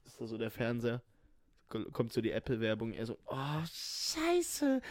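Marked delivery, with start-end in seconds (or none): 3.3-4.8 high startled cry; shocked. Retold or imitated. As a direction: blurt it out in shock. Acted shock at ad.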